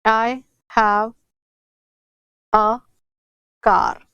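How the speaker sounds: slow, long pauses between words; slow dictation. Shown as a demonstration. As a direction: dictate "I have a car" while writing. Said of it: "और देखते हैं".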